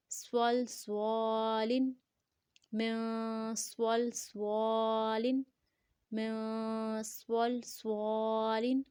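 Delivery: "سوالن" with a full-mouthed s and long vowel; thick, heavy saad. Shown as a demonstration. Teacher models both saads for learners, thick heavy articulation. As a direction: slowly recite "سوال سوالن" with a heavy whistled s. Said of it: "سواد کے ساتھ موٹا بھی پڑھیں گے".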